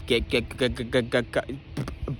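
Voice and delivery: re-enacted stutter, caught on one syllable. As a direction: get stuck repeating "get" in quick little bursts. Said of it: "I was stuttering".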